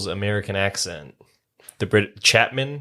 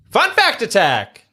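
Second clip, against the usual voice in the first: high, loud, slow segment announcer call; slow, hammed-up game show announcer calling out the segment; announcer shout; 11 semitones higher, 5 dB louder than usual. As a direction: belt out the segment name like a game show host. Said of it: "announcing a segment".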